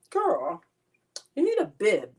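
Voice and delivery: sass the viewer; sassy. Real, not acted. High sassy call.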